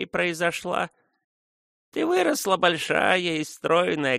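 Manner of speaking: put-on fond old woman reminiscing; tender old woman. A narrator's reading. Old nurse recalls Frona's childhood.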